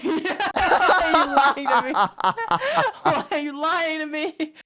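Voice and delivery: loud, high wail through laughter; laughing disbelief. Retold or imitated. Retelling disbelief at the dog walker's reassurance, loud laughing wail. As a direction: protest helplessly while cracking up.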